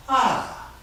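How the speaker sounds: ordinary delivery, nothing marked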